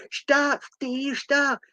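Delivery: put-on high-pitched kid calling stop; hammed-up high-pitched alarm; mock alarmed cry. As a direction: act out a high, urgent protest. Kid confronting thieves in retold film.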